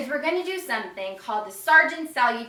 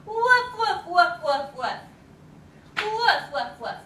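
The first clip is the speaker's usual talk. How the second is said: higher, playful barking; bouncy woof woof; dog barks; 3 semitones higher than usual. Shown as a demonstration. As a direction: yap like a happy puppy. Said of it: "they wag their tail like this".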